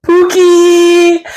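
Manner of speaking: Pookie yelled very high and loud; playful shout. Pretend. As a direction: call out Pookie like to a pet. Calling out the name.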